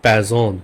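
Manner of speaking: প্যাজন said 'peh-ZOHN', Z sound; German pronunciation. Shown as a demonstration. Teacher gives a P example, প্যাজন as 'peh-zohn'. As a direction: model প্যাজন whole, R left silent. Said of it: "পে দিয়ে একটা example".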